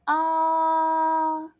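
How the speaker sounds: AH held high and level; long steady AH, no rise or fall; first tone. Shown as a demonstration. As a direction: draw AH out in a straight line, high. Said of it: "you go in a straight line".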